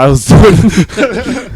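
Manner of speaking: high, loud, drawn-out through laughter; helpless giggly delivery; laughing joke. Real, not acted. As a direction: crack up mid-sentence. Joking admission, cracking up.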